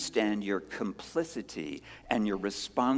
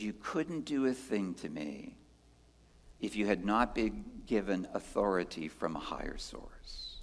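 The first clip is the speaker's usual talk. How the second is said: softer, calm, steady words to Pilate; calm Jesus; 4 semitones lower, 8 dB quieter than usual. Acted. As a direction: hold it quiet and composed. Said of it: "he looks at Pilate and says".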